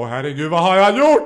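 loud, rising mock panic; panikslagen ånger. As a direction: wail it in hammed-up horror. Acts out horrified morning-after reaction. (spelad panik, högt och gällt)

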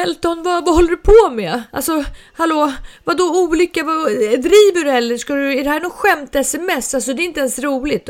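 loud, high, rapid-fire disbelief; agitated confrontation. Retold or imitated. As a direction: re-enact an upset mum demanding answers from her son. Retold confrontation of her son.

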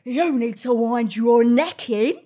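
slow, loud, scolding; stern telling-off. Retold or imitated. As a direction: tell her off slowly and loudly. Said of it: "goes to me".

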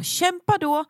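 high, fired-up challenge; fierce pep talk. Real, not acted. Host urges women listeners to fight, fired up. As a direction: push them to fight back.

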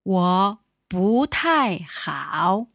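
slow, careful 我不太好; slow model phrase. Shown as a demonstration. Teacher modeling 我不太好 for a student, slow and clear.

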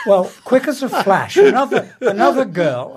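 talking through laughter; words broken up by laughs; laughing. Real, not acted.

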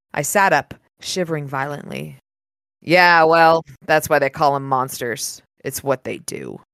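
low, even audiobook reading; story narration. A narrator's reading. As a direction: read the passage like a novel narrator. Reads the novel aloud.

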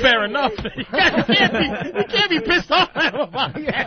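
high laughing protest; laughing while sticking up for the caller. Real, not acted. Defending the caller while laughing.

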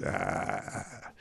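low, hushed roar; very soft imitated yell; mock celebration roar. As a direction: roar under your breath. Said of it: "and then he goes".

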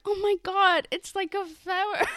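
high, loud gasping wonder; mock awe. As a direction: gush like a dim but delighted girl. Chrysella voiced awed.

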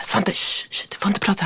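whispered shushing; hushing whisper. Retold or imitated. Mother whispers to her son to be quiet in the cinema. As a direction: hush him so nobody hears.

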